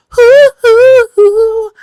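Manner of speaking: very high, loud hooting; long falsetto "hoo" cheers; high campy whoops. Pretend. Whooping for the mock nickname.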